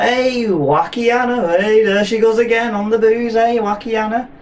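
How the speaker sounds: high, drawn-out teasing holler; mock rowdy call. Office party crowd hailing Wakiana on the booze, mock rowdy call. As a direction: call the name out in a high sing-out.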